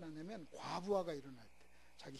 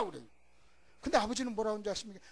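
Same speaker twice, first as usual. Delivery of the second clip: ordinary delivery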